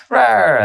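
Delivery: high mock "rawr" sliding down; playful roar. Player moves a model into the squad, playful roar. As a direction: toss out a toy-monster roar. Voicing a model joining the charge.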